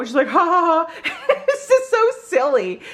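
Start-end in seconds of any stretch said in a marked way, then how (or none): 0.3-1.3 mock high-pitched ha-ha; imitated laughing. Vlogger imitating a cashier's laughter, high mock laugh. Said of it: "laughing and stuff". 1.4-2.8 amused laughing. Real, not acted. Amused by her own story.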